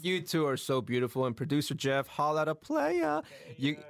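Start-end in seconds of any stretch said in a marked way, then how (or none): 2.1-3.2 playful slang shout-out. Pretend.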